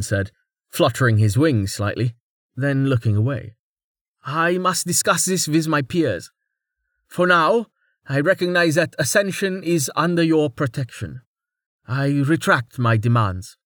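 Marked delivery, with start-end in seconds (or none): 4.2-13.6 higher, hurried climb-down; lightly voiced lord retreating politely; flustered birdman lord. A narrator's reading. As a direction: back off while keeping face. Matagar voiced by narrator.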